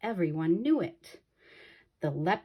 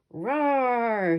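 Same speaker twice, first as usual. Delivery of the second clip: lion's roar, raised and louder; hollered roar; 5 semitones higher, 4 dB louder than usual. Acted. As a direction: leap out at the man with a roar. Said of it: "he hollered".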